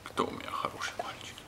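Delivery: soft whispered pet talk; whispering. Real, not acted. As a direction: whisper it tenderly to the dog. Praising the dog softly.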